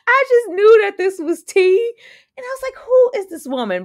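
high and loud, amazed; shocked she doesn't recognise her; excited disbelief. Real, not acted. Vlogger reacting to the character, high excited disbelief.

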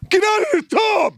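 father suddenly yelling at his daughter in the bath. Retold or imitated. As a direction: bellow it out of nowhere to shock her. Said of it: "if I yell at her".

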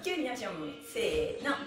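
ordinary delivery

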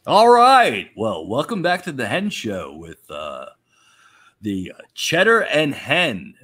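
loud, slow show-host welcome; show host intro. Acted.

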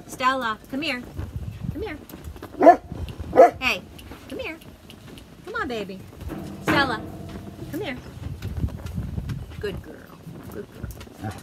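calling a dog, loud on her name; real call to the dog Stella. Real, not acted. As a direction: coax the dog back to you.

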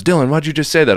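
high, loud mock holler of alarm; retold hollering. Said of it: "he's hollering at me".

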